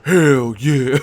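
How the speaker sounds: loud hammed-up shout; mock shout. Mock Mike shouting hell yeah to the audience. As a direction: bellow it out as a joke.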